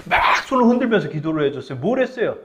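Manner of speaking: ordinary talk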